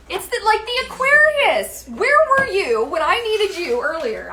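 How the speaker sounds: high, loud burst of recognition; excited exclamation. Real, not acted. Finally remembering the Aquarius fin, excited exclamation.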